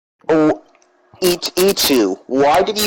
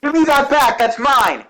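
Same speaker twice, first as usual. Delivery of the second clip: high cry of outrage; upset shout; 7 semitones higher than usual. Real, not acted. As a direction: yell for your stuff back. Item stolen in game.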